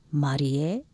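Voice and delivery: Marie said the Japanese way, slowly, ending on a rising note; Japanese pronunciation. Shown as a demonstration. Explaining a Japanese name to partner, Japanese pronunciation. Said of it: "how to pronounce the name properly".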